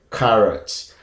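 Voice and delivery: "carrot" with an open "ah", KAH-rot; first pronunciation. Shown as a demonstration. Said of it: "The first pronunciation is".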